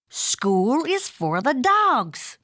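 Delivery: Mama insisting, drawn out and firm; emphatic mama cat. A narrator's reading. Mother cat lecturing her kitten, slow emphatic voice. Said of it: "stated Mama Junebug Jones emphatically".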